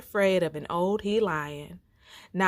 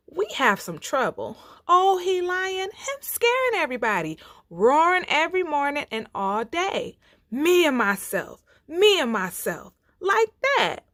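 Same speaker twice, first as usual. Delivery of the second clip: scared little animals; 8 semitones higher, 4 dB louder than usual. Acted.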